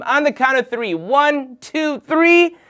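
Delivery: high, loud count; rally everyone on a rising count; rousing count-in. Real, not acted. Host counts the kids in to say it together, rousing. Counting in audience to chant along.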